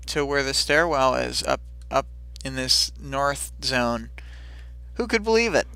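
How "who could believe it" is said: high-pitched feigned disbelief; mock amazement. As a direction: play at being astonished, pitch way up.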